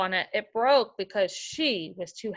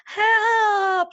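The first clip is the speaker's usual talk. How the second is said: much higher and louder, a real scream; scream for help; 11 semitones higher, 6 dB louder than usual. Acted. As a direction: shriek it as Goldilocks flees the bears. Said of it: "she screamed".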